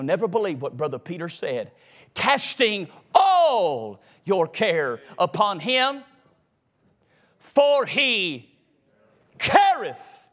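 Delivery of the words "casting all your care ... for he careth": slow, spaced out, rising to a high "careth"; weight each phrase, with long pauses before the climax; emphatic verse declamation. Acted. Preacher revealing Brother Peter's words, emphatic verse declamation.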